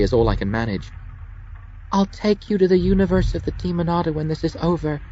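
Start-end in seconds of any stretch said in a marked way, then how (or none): 1.9-5.0 high, sweet sinister promise; Junie promising. Acted. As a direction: coo the threat like a lover. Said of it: "Junie promises Dervish".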